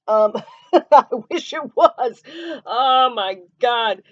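laughing out loud at her own joke. Real, not acted. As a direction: burst out laughing, then a slow oh my God.

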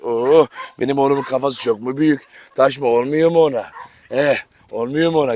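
sweet-talking the dog about its big head. Real, not acted. Baby talk to dog.

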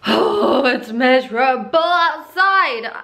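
loud, drawn-out complaint about the weather; moaning loudly at the rain, slowly; loud groan. Real, not acted. Vlogger moaning about the rain to viewers, loud and drawn out. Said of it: "I'm sorry for screaming".

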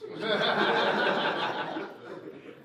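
laughing hard through the breath; breathy laugh. Real, not acted. Rapper laughing at his own fajita joke, airy laugh.

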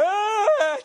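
high sudden yelp as the ice hits; mock yelp from ice water. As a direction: shriek like cold water just hit you. Mimics someone getting doused.

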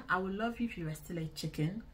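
ordinary talk, nothing marked

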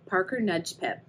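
plain reading voice

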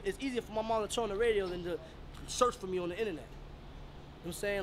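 ordinary talk

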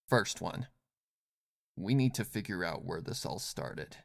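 low, soft commanding voice; quietly in charge; calm commanding woman. Acted. Yelan giving orders.

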